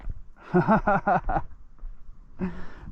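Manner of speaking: long, delighted chuckling; gleeful laugh. Real, not acted. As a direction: laugh happily over the catch. Laughs after another catch.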